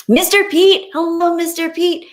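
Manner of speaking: high, loud, bright hello; excited greeting. Real, not acted. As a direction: sing out his name happily.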